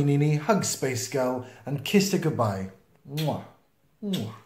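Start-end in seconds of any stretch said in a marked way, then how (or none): 3.1-4.3 smacking mwah kisses; kiss sounds. Acted. Mummies kissing Space Girl goodbye, kiss sounds.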